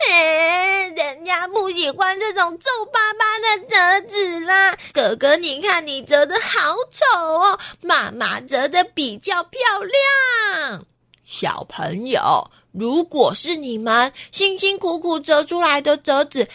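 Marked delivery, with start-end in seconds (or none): 0.1-10.9 put-on high, crying whine of a spoiled little girl; whiny little sister. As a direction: whimper it like a sulky little sister complaining. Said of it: "我妹妹就一直说". (高声哭腔撒娇的妹妹)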